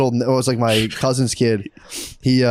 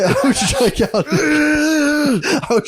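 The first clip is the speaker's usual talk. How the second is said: mock crying; 13 semitones higher, much slower than usual. Recalling childhood crying fits.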